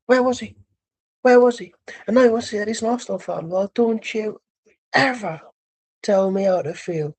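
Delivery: high, indignant fan rant with sudden rushes; Ty's mannerisms. Acted. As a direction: imitate a worked-up fan demanding answers. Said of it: "The mannerisms are there".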